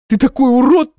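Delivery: high, loud tearful insult; angry exclamation. Acted. Crying girlfriend insulting her ex, high loud cry. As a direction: hurl it at him. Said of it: "воскликнула девушка".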